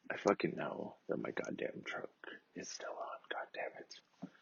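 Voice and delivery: furious whisper under his breath; whispered curse at the woman nagging him; angry whisper. Retold or imitated. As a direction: hiss it to yourself, seething. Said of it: "and I'm like".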